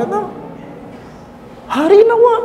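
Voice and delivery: a teasing high-pitched amen; mock high prayer. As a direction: squeak out "Hari nawa" in mock piety. Mocking the prayer phrase.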